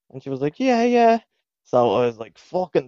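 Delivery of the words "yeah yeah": put-on high little Wexford girl; mock Wexford accent. Girl agreeing to the cousin's plan, mock Wexford accent. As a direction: pitch it way up, imitating a Wexford girl. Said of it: "they had that horrible accent".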